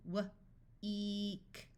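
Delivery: W alone, a pause, then a long "eek"; sounded out. Shown as a demonstration. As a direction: break "W-eek" into its sounds slowly. Phonics teacher sounds out, then blends.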